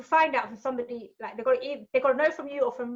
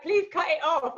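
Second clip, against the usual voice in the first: higher-pitched pleading in retold panic; panicked plea; 6 semitones higher, slower than usual. Presenter begging crew to cut mum's call, panicked plea. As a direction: beg them, mock-desperate.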